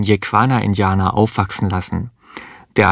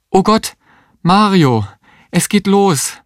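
much higher, panicked; alarmed cry; 10 semitones higher than usual. Acted. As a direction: labour has begun, gasp it out. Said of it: "rief die Existenzialistin". (erschrockener Ruf, viel höher)

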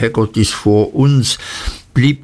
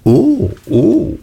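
high, swooping pair of ohs; knowing oh; 9 semitones higher than usual. A narrator's reading. Boy trying to look clever for Barkis, high swooping ohs. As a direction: let each oh leap up high, as if catching on.

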